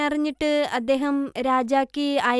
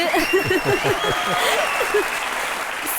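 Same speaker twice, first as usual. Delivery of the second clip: long, high peal of laughter; 5 semitones higher than usual. Real, not acted. Guest amused by her praise of crying, high laugh.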